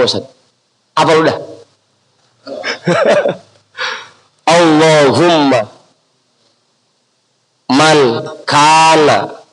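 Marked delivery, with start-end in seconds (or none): 4.5-9.4 slow, word by word with long vowels; dictated prayer reading. Shown as a demonstration. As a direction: dictate the prayer, pausing after each word. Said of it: "Itu bacanya". (didikte perlahan kata demi kata)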